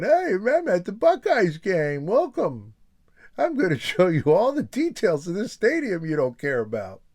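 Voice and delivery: high goofy voice of the stadium guy; imitating stadium photographer. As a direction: ham up an eager guide at the Buckeyes game. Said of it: "That's me over here. I'm saying".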